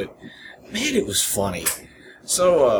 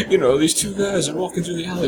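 normal talking voice

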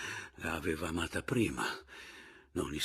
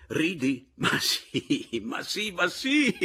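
high, loud, half-laughing cries; yelling; 10 semitones higher, 6 dB louder, slower than usual. A narrator's reading. Husband shakes his astonished brother, yelling. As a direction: climb to a drawn-out high shout. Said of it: "gli gridai".

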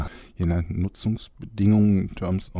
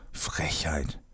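unvoiced, softer muttered insult; whispered aside; 7 dB quieter than usual. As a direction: hiss it under your breath. Muttered under his breath. (leise geflüstert: „Frechheit“)

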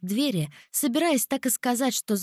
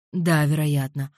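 lower, darker man's voice; low male voice; 6 semitones lower, 3 dB louder than usual. A narrator's reading. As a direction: voice him low and calm. Alvir answers.

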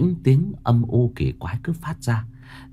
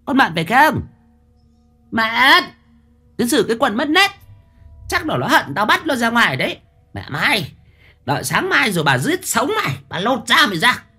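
put-on high, sharp threats from a cruel old woman; spiteful old hag; 13 semitones higher than usual. Mistress Mão vowing to beat the servant, high hag threats. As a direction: threaten her like a vicious mistress.

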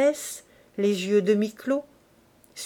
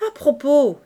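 louder sudden exclamation; exclaiming; 6 semitones higher, 9 dB louder than usual. Acted. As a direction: burst out with a new thought. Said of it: "s'écria-t-il". (Lantier s'écrie, plus fort et plus aigu)

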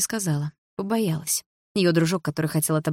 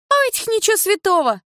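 much higher, louder shriek; shrieking woman; 5 dB louder than usual. A narrator's reading. The woman chimes in, very high and loud. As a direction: squeal with outrage.

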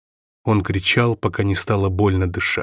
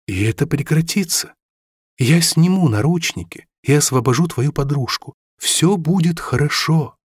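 sly coaxing; 5 semitones higher than usual. A narrator's reading. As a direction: soothe him like a liar would. Villain's false promise.

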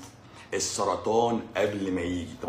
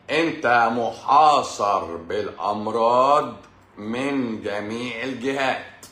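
very slow, drawn-out words; slow emphatic proclamation; slow dramatic warning; 3 dB louder, much slower than usual. Real, not acted. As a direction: stretch out each word ominously.

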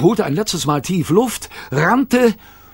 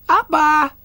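put-on much higher baby babble; 14 semitones higher, 4 dB louder than usual. A narrator's reading. Kayla babbling to her father, much higher baby voice. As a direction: say it like a toddler.

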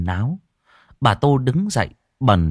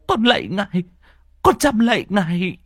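higher, begging, stretching out con; humble bowing plea; 10 semitones higher than usual. Acted. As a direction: grovel before the spirit. Old woman praying at shrine. (con lạy ngài, giọng van lơn)

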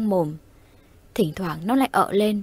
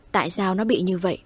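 ordinary delivery, nothing marked

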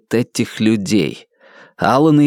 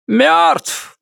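shouted over the radio. A narrator's reading. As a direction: yell it over the crackle. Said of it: "прокричал Ван Аллен в разрыв в статике".

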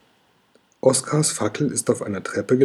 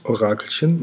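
ordinary delivery

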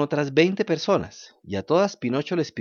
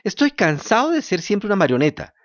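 high, louder fed-up puppet cry; shout; 9 semitones higher, 4 dB louder than usual. Acted. Pinocho, slapping himself, cries out to the fairy in a high frustrated shout. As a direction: yell it in frustration at yourself. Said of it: "gritó Pinocho dándose un bofetón".

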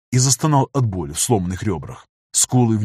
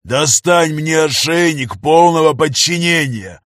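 loud, slow furious demand; angry shout; 5 semitones higher, 5 dB louder, slower than usual. Acted. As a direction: shout the order at her. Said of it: "заорал негр на рабыню".

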